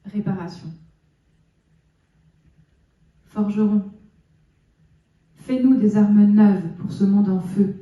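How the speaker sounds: measured poem recital with long pauses; solemn public reading of a poem; poetry reading. Acted. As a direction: recite it line by line, letting each phrase stand. Reads own poems aloud.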